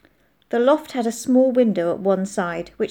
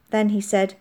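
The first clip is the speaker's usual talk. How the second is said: usual speaking voice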